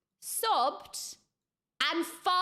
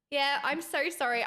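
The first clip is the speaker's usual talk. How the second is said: ordinary talk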